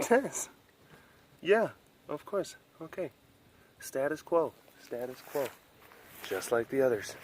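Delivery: very soft murmur to the cats; gentle whispery pet talk; hushed pet talk. Real, not acted. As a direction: coo quietly so they stay asleep.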